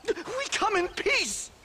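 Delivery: high, slow, desperate cry for peace; panicked plea. Acted. Professor at gunpoint pleading, high and slow. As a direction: beg them not to shoot.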